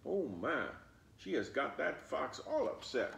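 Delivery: ordinary delivery, nothing marked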